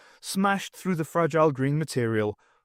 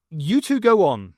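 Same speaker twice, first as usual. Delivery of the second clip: louder, brave holodrama hero; heroic line; 4 semitones higher, 6 dB louder than usual. A narrator's reading. Stokes sending his comrades away, mock heroic. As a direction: play the noble hero. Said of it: "plucking his words ... a holodrama".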